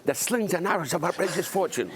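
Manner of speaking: put-on high-pitched small child reciting Shakespeare; imitating a toddler. Actor recalling his young pupil's performance, imitated toddler voice. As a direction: mimic a two-year-old's high-voiced Hamlet. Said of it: "He just went".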